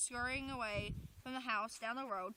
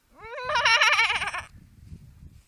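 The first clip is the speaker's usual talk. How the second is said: high, very loud rising ghostly howl; ghost wail; 10 semitones higher, 16 dB louder than usual. Acted. Ghost bumped by Larry, very loud spooky wail. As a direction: let out a big spooky wail.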